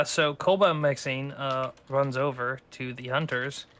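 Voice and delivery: ordinary delivery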